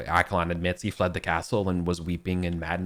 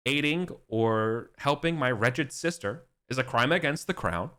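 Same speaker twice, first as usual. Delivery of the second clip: higher, a lightly hammed-up king proclaiming; royal decree; 5 semitones higher than usual. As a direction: proclaim the new law to the court. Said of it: "he's like".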